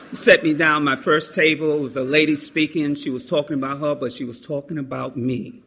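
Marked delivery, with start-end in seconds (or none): none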